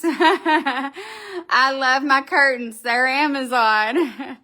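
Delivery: slow, laughing through the words. Real, not acted. Gushing to viewers about her curtains, laughing. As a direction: giggle while gushing.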